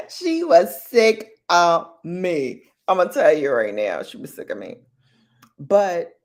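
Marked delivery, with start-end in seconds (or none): 0.1-2.6 She was sick of me stretched slow and louder; drawn-out emphasis. Real, not acted. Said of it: "repeated punchline". 4.0-4.8 unvoiced whispered repeat. Real, not acted.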